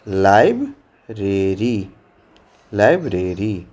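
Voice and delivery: teacher repeating slowly; slow model word. Shown as a demonstration. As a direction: say library very slowly. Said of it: "तो इसको क्या कहेंगे?".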